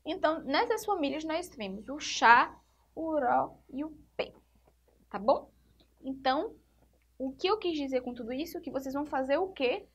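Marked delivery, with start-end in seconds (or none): none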